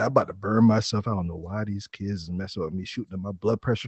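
low, soft, fast grumble; grumbling old man. Acted. Old man fuming about the kids, low fast grumble.

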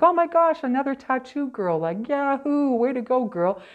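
high, loud, gushing delight; excited gush. Retold or imitated. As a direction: squeal it like meeting a kindred spirit. Greeting fellow tattooed girl excitedly.

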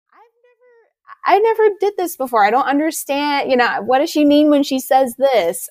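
high-pitched, exasperated confusion; bewildered beginner. Retold or imitated. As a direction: play your baffled beginner self. Acting out her confused beginner self.

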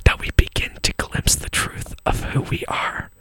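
breathy whisper close to the mic; giggly prank whisper. Acted. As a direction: whisper it like a mock guru. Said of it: "just whisper into the mic".

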